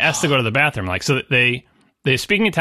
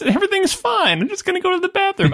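much higher, sing-song and easygoing; breezy and friendly, nothing to worry about; breezy reassurance. Retold or imitated. Michael should be putting the men at ease, breezy and high. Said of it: "in great Goodfellas style of being like".

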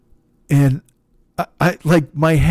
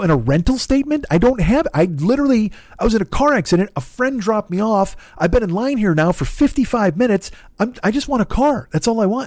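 exasperated pleading; 8 semitones higher than usual. Retold or imitated.

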